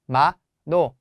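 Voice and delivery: 마노 said as two separate syllables, 마, 노; split into syllables. Shown as a demonstration. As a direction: break 마노 apart with a pause after 마. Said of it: "한국말로 발음하면은".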